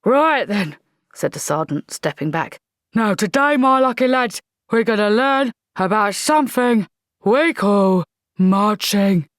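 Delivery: loud barking drill sergeant; gruff sergeant. Acted. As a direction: bark it like an old army sergeant.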